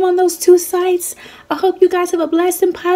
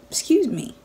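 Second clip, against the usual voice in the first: ordinary delivery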